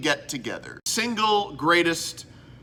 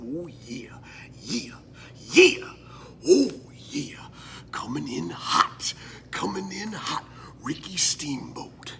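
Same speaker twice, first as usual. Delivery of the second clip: Macho Man whisper; 2 semitones lower, 6 dB quieter than usual. Retold or imitated. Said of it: "I feel like the Macho Man, Randy said".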